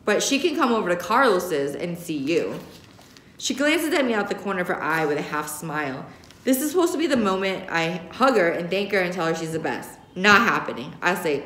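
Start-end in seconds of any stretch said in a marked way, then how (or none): none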